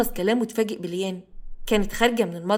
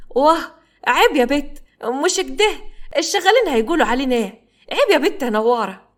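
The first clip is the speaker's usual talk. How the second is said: high, loud, flustered scolding; mock-scandalized old lady; flustered protest; 5 semitones higher, 4 dB louder than usual. A narrator's reading. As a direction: push her off, giggly and scandalized. Mother-in-law protesting tickling.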